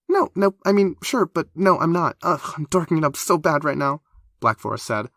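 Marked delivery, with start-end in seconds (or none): 0.1-4.0 high, loud, rushed panic; flustered backpedaling. A narrator's reading. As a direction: scramble to take it back. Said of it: "eyes wide and horrified".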